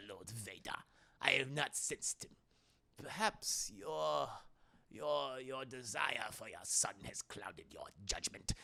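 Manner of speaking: very soft, low, slow sinister emperor; Palpatine. Acted. Emperor doubting Vader's judgment, hushed and sinister. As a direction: sink into a whispery evil-ruler voice.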